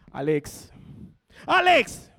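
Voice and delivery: name snapped out high and loud; losing patience with a child; exasperated yell. Retold or imitated. Father loses patience. (papá gritándole al hijo, desesperado)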